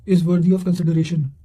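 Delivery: ordinary talk, nothing marked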